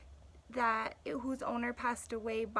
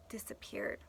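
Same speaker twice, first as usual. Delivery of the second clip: lively, louder storytelling voice for the class; story read aloud; 6 dB louder than usual. A narrator's reading. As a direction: read it like a gripping tale to kids. Teacher reads story aloud to class.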